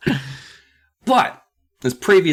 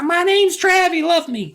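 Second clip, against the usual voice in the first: mock little kid. Mock kid introducing himself.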